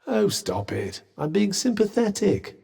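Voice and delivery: ordinary talk, nothing marked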